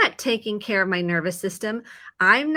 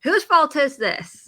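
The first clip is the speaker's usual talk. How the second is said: higher, louder and slow, hammed-up indignation; mock frustrated driver; 7 semitones higher, 5 dB louder, slower than usual. A driver stuck in construction traffic demands who is to blame, mock exasperation. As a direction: demand an answer like a fed-up driver. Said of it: "we're like".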